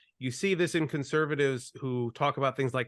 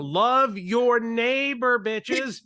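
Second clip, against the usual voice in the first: speaking louder; 8 semitones higher, 6 dB louder than usual. Pretend. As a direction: belt it to the back row. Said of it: "Let me speak louder for the people in the back".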